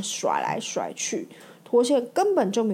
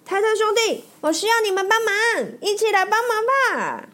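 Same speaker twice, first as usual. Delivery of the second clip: much higher, eager shout; rally the brothers to come help; calling for help; 11 semitones higher than usual. Acted. Mater shouting to the Tate brothers for help. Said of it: "脱线大喊".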